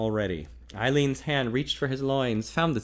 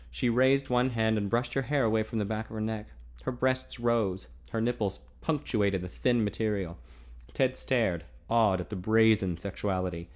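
usual speaking voice

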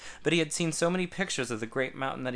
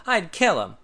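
outraged; 6 semitones higher, 7 dB louder than usual. A narrator's reading. Tad outraged.

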